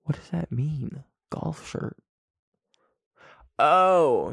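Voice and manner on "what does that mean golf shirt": very soft, half-whispered musing; hushed, trailing into a whisper; puzzled murmur to self. Real, not acted. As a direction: think aloud under your breath, puzzled. Puzzles over text to himself.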